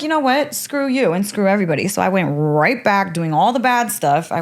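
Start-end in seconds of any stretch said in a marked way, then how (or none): 0.0-1.9 retold defiance. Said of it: "that pissed me off".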